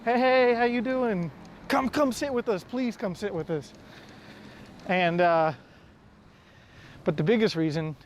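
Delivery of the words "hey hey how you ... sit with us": eager friendly welcome. Retold or imitated. Traveler imitates Vietnamese locals inviting him over, eagerly. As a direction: wave a stranger over to your table, all warmth. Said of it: "they're like instantly".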